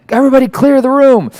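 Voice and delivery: high, alarmed call to clear the room; half-joking panicked order; mock panic. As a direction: sound the alarm like everyone must flee. Panicked alarm at imagined train.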